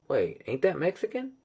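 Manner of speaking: high-pitched, naive confusion; mock puzzlement, rising high; confused question. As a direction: play your younger, clueless self asking. Retells his own ignorant question.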